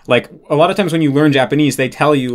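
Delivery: ordinary delivery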